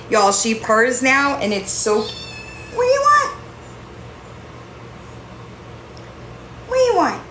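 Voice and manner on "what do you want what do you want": high sing-song pet voice. Real, not acted. Cooing to the purring cat.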